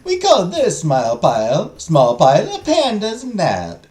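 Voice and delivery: put-on Italian accent. Merchant naming tea blends in an Italian accent. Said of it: "my Italian accent".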